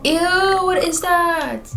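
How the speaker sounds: high, loud, drawn-out revulsion; slow high squeal of disgust; horrified disgust. Acted. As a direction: stretch it out in a grossed-out wail. Acted disgust at thing in sink.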